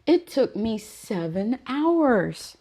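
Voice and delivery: hoger, traag verbaasd; verbijsterde eigenaar. Retold or imitated. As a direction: rek het ongelovig uit. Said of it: "She's like".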